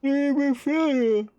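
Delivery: high, loud word stretched through a yawn; real yawn. Real, not acted. Streamer caught mid-reading by a yawn, yawning the word out. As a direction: talk right through a big yawn.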